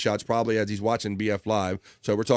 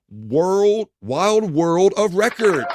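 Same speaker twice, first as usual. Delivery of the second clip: big slow showman announcement, higher and louder; segment announcer; 7 semitones higher, 6 dB louder, much slower than usual. Acted. Host announces his new segment to viewers, booming showman voice. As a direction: stretch the title out like a game show host.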